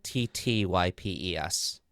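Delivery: letters spelled slowly one by one. Shown as a demonstration.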